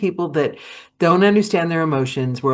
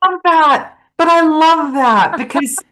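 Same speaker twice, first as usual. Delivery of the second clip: high, loud, gushing delight; 12 semitones higher, 5 dB louder than usual. Real, not acted. Host delighted by her guest's cart story, gushing high. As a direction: gush over the story, pitched way up.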